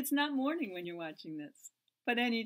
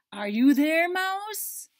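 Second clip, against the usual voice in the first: loud, higher searching call; 7 semitones higher, 14 dB louder, much slower than usual. Acted. Cat calling under the pillow, loud. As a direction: call out to the mouse.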